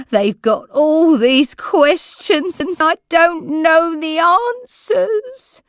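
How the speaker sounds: high, loud, slow sobbing words; crying. A narrator's reading. Old lady in tears telling carer she is watched, crying. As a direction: weep it out, frightened and lost. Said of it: "she burst into tears".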